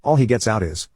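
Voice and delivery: ordinary delivery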